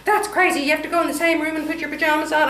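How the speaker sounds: high, fast mock-incredulous husband; imitating husband. Wife imitating her husband scoffing at the pajama plan, high and fast. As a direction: rattle it off high and quick like a baffled husband. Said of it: "He said".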